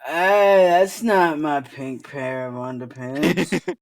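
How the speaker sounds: put-on dragged-out drunk objection; drunken protest. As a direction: protest like a drunk being shaken. Drunk being shaken awake, protesting.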